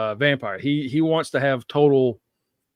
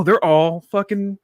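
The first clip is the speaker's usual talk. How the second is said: higher, louder, drawn-out disbelief; acted astonished discovery; 7 semitones higher, 6 dB louder, slower than usual. Telling how he finds the coven alive, astonished. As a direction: stretch it out in mock amazement.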